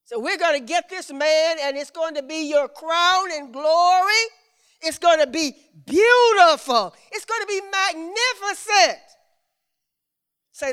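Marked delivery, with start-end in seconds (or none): 0.1-9.0 high, rousing preacher's proclamation; preaching. Acted. Preacher tells of man as crown and glory, preaching rousingly.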